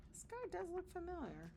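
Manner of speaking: ordinary delivery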